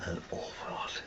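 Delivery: hushed whisper; quick whispered aside. Real, not acted. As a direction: say it under your breath. Muttered aside.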